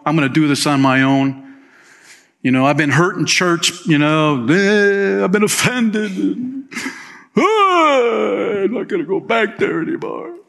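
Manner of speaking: high, mock-whining churchgoer; whiny lone believer. Pastor mocking a hurt churchgoer, high whiny voice. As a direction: whine like a hurt church member. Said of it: "if you've been playing that game".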